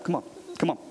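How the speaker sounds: ordinary talk, nothing marked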